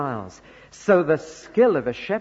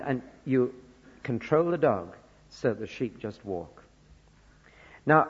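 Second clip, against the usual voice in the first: ordinary talk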